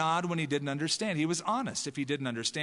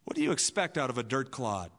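ordinary talk

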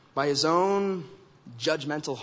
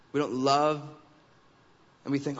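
ordinary talk

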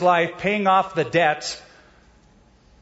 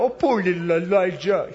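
mock pity; 3 semitones higher than usual. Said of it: "God didn't go up to Elijah and go".

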